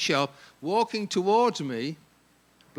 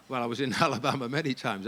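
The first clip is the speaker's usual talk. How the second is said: usual speaking voice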